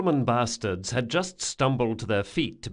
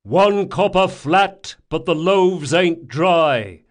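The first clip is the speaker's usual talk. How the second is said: slow, high, loud countersign; drawn-out bellowed response; shouted reply; 7 semitones higher, 9 dB louder, slower than usual. Acted. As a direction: holler the answer back through the door. Password shouted back through door.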